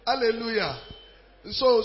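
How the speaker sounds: ordinary talk, nothing marked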